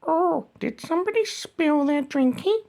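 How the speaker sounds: ordinary talk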